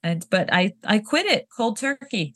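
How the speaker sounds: ordinary delivery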